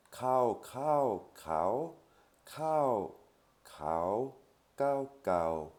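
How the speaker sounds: kao said over and over, each kao on a different Thai tone, some pitched high; Thai tone contrasts. Shown as a demonstration. Teacher to French learners showing that kao changes meaning with the tone. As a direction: sing out each kao with its own tone, like a tone drill. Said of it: "Qui veulent dire trois choses différentes".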